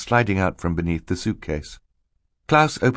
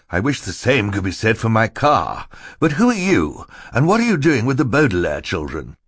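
higher, louder questioning; querulous banker; 5 semitones higher, 4 dB louder than usual. Acted. Mister Poe questions Stefano about the children, querulous. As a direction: demand to know who this is.

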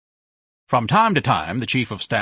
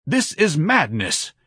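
slow, higher-pitched plea; imploring protest; 4 semitones higher, slower than usual. A narrator's reading. Narrator protesting to his own godlike mind, slow imploring cry. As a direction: plead slowly, drawing the words out. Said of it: "I cried imploringly to my godlike mind".

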